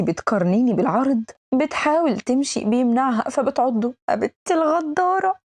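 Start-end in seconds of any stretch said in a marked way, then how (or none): 4.1-5.3 sudden cry, much higher in pitch; angry outburst. Acted. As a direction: yelp out the insult after being bitten. Bitten, cursing her. (صرخة غضب بعد العضة)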